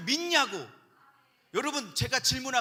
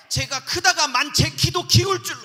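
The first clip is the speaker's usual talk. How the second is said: loud, higher, hammed-up faith shout; mock fervent declaration; 5 semitones higher, 9 dB louder than usual. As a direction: belt it out as a joking confession. Joking over-the-top declaration of faith. (과장되게 외치는 믿음의 고백)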